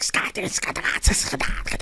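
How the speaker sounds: rapid high-pitched scat babble; scatting noise. Retold or imitated. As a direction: imitate a man scatting nonsense at speed. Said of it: "he's just, like, making a noise".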